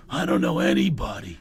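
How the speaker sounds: low, raspy actor voice; worn-out low impersonation; Don Johnson. Retold or imitated. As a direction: drop it into a low rasp. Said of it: "He goes".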